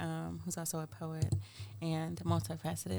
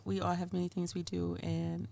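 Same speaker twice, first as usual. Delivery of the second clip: ordinary delivery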